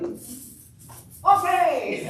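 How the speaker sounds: high, booming, jokey okay; playful over-loud yell; exaggerated loud shout. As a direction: overdo the volume for someone who can't hear. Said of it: "Can you talk a little louder?".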